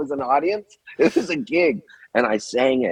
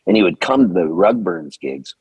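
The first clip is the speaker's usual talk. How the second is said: ordinary talk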